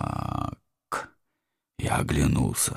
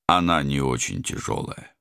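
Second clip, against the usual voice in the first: usual speaking voice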